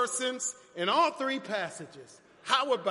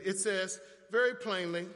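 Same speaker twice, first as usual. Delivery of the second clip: ordinary talk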